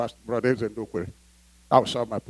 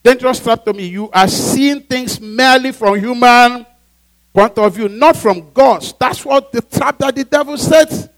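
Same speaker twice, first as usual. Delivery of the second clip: loud, raised-pitch sermon; full-force preaching; 6 semitones higher, 7 dB louder, slower than usual. Acted. Preacher warning the church of Satan's trap, forceful preaching. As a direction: drive it home to the congregation.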